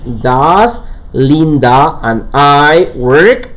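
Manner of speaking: very slow, drawn-out English question; rising, careful dictation; slow model sentence. Shown as a demonstration. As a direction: spell it out word by word for the class. Wrong version read slowly.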